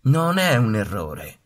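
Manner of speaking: mamma anatra ribatte. A narrator's reading. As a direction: defend her chick firmly. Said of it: "rispose mamma anatra". (mamma anatra che difende il piccolo, decisa e lenta)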